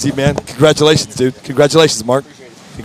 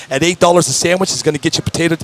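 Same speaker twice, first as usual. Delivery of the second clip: normal talking voice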